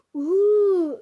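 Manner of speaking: loud, high, drawn-out ooh; excited cry. A narrator's reading. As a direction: whoop with delight, high and loud. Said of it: "rief Clara". (lauter, begeisterter Ausruf)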